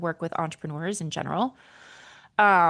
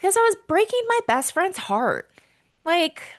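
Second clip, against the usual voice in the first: emotional; 10 semitones higher than usual. Real, not acted. Telling listeners about asking for divorce, genuinely emotional. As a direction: voice lifting high with real feeling. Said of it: "I'm gonna get emotional about it".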